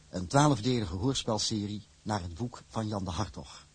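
usual speaking voice

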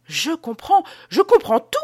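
high, loud cry of sudden understanding; excited outburst, climbing in pitch; exclaiming. A narrator's reading. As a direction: cry out, rising to a peak on the last word. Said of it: "s'écria Théophraste".

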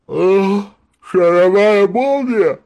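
slow yawning voice; yawning sleepy bear. A narrator's reading. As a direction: yawn through the words. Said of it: "зевая спросил медведь".

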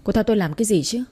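plain reading voice

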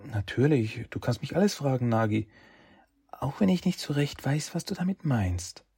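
put-on young woman's voice. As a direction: voice her kindly but puzzled. Narrator voices Shen higher.

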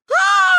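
loud, very high-pitched scream; children's scream. A narrator's reading. Children yelling at mother from above, screaming. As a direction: yell down from above like panicking kids. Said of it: "орали мы ей сверху".

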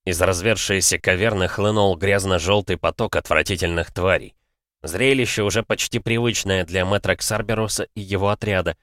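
ordinary delivery, nothing marked

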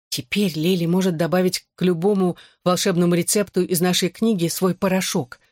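plain reading voice

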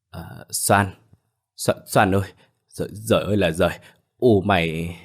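halting, choked-up dialogue in a changed voice; tearful, faltering. A narrator's reading. Bà Cảnh brings Soan bad news, tearful and faltering. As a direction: stammer it out as if close to weeping. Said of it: "mếu máo nói không thành lời".

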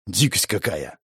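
quiet incredulous remark; disbelieving. A narrator's reading. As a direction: scoff in disbelief. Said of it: "с недоверием отозвался ратник". (с недоверием)

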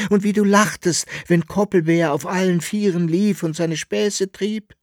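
tight, pressed voice, high and loud; strangled voice. A narrator's reading. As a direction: plead through a tight throat. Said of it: "mit gepresster Stimme".

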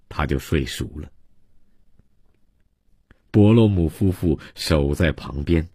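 usual speaking voice

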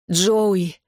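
mildly strained call of the name; hoarse croak. A narrator's reading. Injured sister calls her brother, mildly strained croak. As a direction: call out as if short of air. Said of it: "прохрипела я, хватаясь за грудь".

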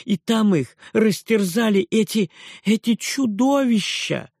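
high, loud indignation; outraged aunt. A narrator's reading. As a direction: cry out in horror. (возмущённая тётя, громко)

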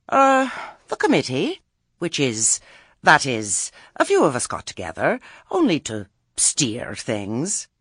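hesitate, then rush to explain; flustered witch. Acted. Explaining the committee to Granny, flustered.

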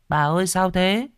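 put-on high little-boy voice; puzzled child. Boy asks grandma why he must pretend, puzzled.

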